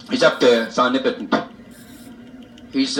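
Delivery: ordinary delivery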